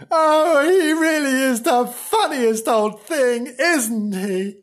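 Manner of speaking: high, loud exclaiming; exaggerated tickled delight; hammed-up amusement. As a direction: gush at high pitch, easing off at the end. Mocking exclamation over the character.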